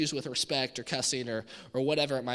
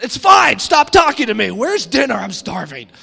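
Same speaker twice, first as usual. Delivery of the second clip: loud, much higher irritated snapping; hangry outburst; 6 semitones higher, 16 dB louder than usual. Retold or imitated. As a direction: bite the wife's head off out of hunger. Hangry husband snaps at wife.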